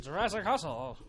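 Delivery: announcer voice. Acted. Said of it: "repeats show title theatrically".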